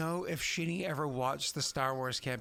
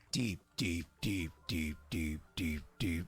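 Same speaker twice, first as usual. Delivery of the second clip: much lower, evenly spaced repeats; deep repeated echo; 10 semitones lower, slower than usual. Pretend. Game master describes the crushing deep, low dramatic echo.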